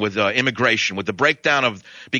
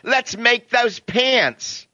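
mocking fashion types; 11 semitones higher, much slower than usual. Host mocking fashion-industry people gushing over sagging pants. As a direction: ham up a gushing fashion insider. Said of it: "who ape the degenerates on the streets said".

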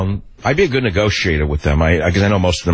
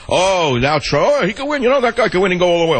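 high, fast mock-gushing voice of fickle pundits; mocking doubters; 9 semitones higher than usual. Radio host mocking doubters who now back Trump, high and fast mock gush. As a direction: send up the turncoats suddenly cheering, much higher and quicker. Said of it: "all the doubting Thomases who threw mud at him".

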